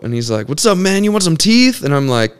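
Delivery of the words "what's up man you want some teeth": much higher, pushy tout voice; street hustler. Retold or imitated. Tattooed guy in scrubs pitching teeth, high tout voice. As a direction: hustle a passerby casually.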